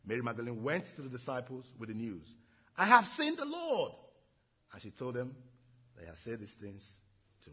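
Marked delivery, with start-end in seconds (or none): 2.8-3.9 high, loud and slow, bursting with joy; excited exclaim. Acted. Mary telling the disciples she saw the Lord, elated cry. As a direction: announce it like the best news ever.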